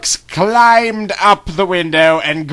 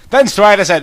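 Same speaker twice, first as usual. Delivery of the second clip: plain reading voice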